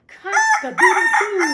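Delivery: very high, loud, slow cock-a-doodle-doo; full-throated rooster call from the roof; rooster crow. Acted. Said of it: "cried down from the beam".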